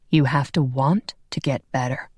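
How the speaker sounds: lightly voiced grown man, calm and sure; a hint of a low, firm male voice; deep voice. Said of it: "A deep voice emanated".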